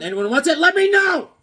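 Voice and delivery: high and loud, calling to viewers. Real, not acted. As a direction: shout the offer to everyone.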